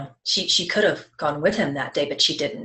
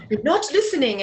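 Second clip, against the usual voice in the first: hammed-up accented "You're not listening" with laughter, higher and louder; mock his accent while laughing; loud laughing imitation of his accented scolding; 8 semitones higher, 3 dB louder than usual. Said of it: "kinda interesting, you know, accent there".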